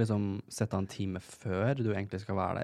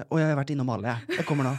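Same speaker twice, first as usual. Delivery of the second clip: louder, higher hurried mock voice; imitating rushed friend; 4 semitones higher, 5 dB louder than usual. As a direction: blurt it out like you've tried every floor. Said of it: "så ringer hun meg". (lett etterligning av stresset venninne)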